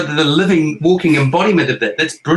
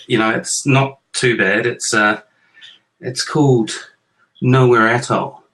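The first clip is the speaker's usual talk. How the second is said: normal talking voice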